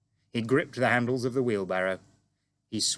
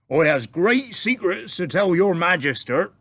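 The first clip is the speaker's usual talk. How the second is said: big booming giant voice, higher and louder; friendly giant; 9 semitones higher, 10 dB louder than usual. A narrator's reading. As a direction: boom it out like an eager, bumbling giant. BFG voiced in story.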